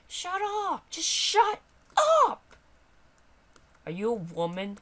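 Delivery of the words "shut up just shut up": high squeal of protest; high-pitched character silencing the player; indignant high little voice; fed-up sidekick voice. Acted.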